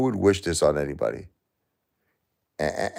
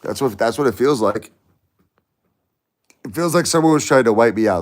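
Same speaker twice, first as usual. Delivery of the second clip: anguished outburst; 8 semitones higher, 8 dB louder than usual. Real, not acted. Raging at losses, feels targeted.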